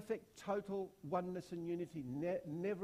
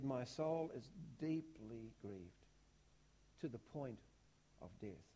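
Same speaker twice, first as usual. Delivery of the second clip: low, slow and soft, heavy with sorrow; grieved, hushed; 6 semitones lower, 4 dB quieter, slower than usual. Acted. Preacher voicing Jesus in anguish, low and slow. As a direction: let the words sink under the weight of grief.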